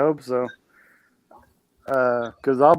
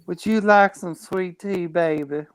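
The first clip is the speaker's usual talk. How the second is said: mock southern accent; 3 semitones higher than usual. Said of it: "You gotta mess with me on my southern accent".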